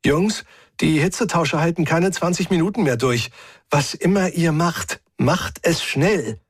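lightly voiced, pressing radio message; urgent comms warning. A narrator's reading. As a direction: warn the team urgently, slowing on the order.

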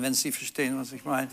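a hushed wink to the audience; suggestive hush. Acted. Suggestive aside about condoms.

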